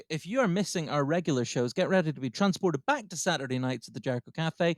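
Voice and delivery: big lead-in to a recorded live set; show announcer intro. Acted. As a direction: rise steadily into introducing the headline act.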